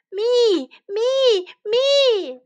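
high, loud eager shouts; shouting. Acted. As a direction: kids begging for a pear. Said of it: "they shouted".

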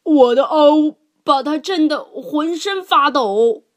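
high, loud bellowing boast; bear roaring. A narrator's reading. Bear roaring his boast at lion and elephant, loud and high. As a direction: roar your brag at the others. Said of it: "熊咆哮道".

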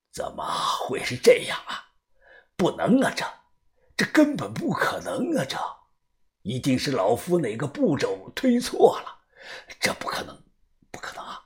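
put-on hushed old man's muttering; shaken disbelief. Old diviner doubting his own reading, hushed and shaken. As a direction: fade into a near-whisper of disbelief. Diviner shocked by impossible result.

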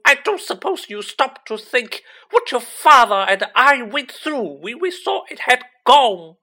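very loud, high-pitched screaming scolding; furious yelling. A narrator's reading. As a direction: bellow like an enraged mother. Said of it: "Missus Weasley's yells, a hundred times louder".

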